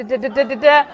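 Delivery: chuckling through the da da da; light laugh rising at the end. Real, not acted. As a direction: let a laugh break into the filler.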